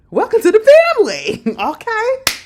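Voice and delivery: high, loud laughing cheer; laughing, loud welcome; gleeful welcome. Real, not acted. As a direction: throw it out with delight. Celebrating her joining family.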